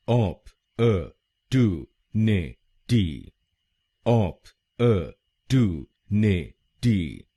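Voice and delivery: ऑप, अ, टू, ने, टी split apart and drawn out slowly; slow American syllables. Shown as a demonstration. Teacher breaking the American word into slow syllables. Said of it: "American pronunciation".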